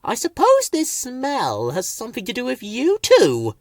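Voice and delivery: high prickly accusing voice; sour suspicion. A narrator's reading. Thistle blaming the two for the smell, sour. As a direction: accuse them with distaste.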